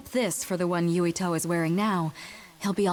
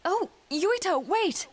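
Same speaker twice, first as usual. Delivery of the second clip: high anxious call after him; 12 semitones higher, slower than usual. Acted. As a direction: call out to stop him leaving. Calling after a running child.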